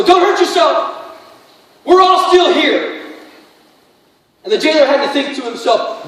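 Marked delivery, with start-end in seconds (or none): 0.0-3.0 higher, louder calling out; urgent call out. Retold or imitated. Paul and Silas stopping the guard, urgent raised call. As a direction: stop him with a raised voice. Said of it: "and Paul and Silas go".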